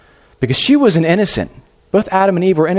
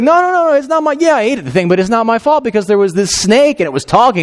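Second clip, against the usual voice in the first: frantic denial; 8 semitones higher than usual. Retold or imitated. Eve to God, shifting blame, high frantic protest. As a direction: squeal out a guilty denial.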